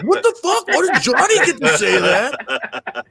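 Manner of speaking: put-on outraged kids squealing through laughter; kids protesting. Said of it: "everybody would be like".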